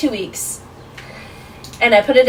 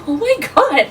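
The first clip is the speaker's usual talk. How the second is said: high, louder burst of shock; shocked disbelief; 13 semitones higher, 3 dB louder than usual. Retold or imitated. Retelling her reaction to hearing everyone hated the roommate, high shocked disbelief. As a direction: gasp it out like you can't believe it.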